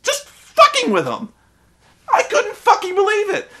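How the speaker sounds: very high-pitched exasperation; squealed indignation; incredulous outrage. Retold or imitated. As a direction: let disbelief squeeze the voice up high. Outraged disbelief at the character.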